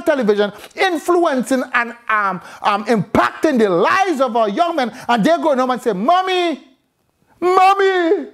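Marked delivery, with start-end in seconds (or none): 5.9-8.3 imitated effeminate son. Preacher mocks young men coming home to mother, imitated effeminate voice. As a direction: mimic a son calling out to his mommy. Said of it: "exactly all different type of words".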